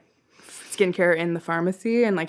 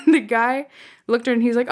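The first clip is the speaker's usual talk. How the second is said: ordinary talk, nothing marked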